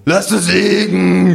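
laut und gedehnt losgrölen; hohes, langsames, betrunkenes Grölen. Acted. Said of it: "gröhl ich". (lautes, gedehntes Grölen)

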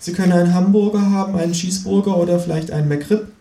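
put-on high-pitched teenage boy voice; high teen counter-clerk voice; sixteen-year-old cashier. A narrator's reading. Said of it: "sagt der sechzehnjährige mit der Kappe".

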